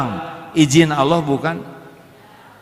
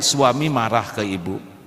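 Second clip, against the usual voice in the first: ordinary talk, nothing marked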